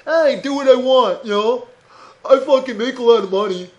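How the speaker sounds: smug put-on voice of a rich driver; mock rich snob. Comic mocks a rich Mercedes driver, smug put-on voice. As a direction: play the smug rich jerk. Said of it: "Some rich fucking asshole".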